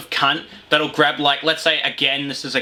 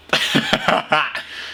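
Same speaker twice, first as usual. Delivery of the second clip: high cackling laugh. Real, not acted. Laugh after rant.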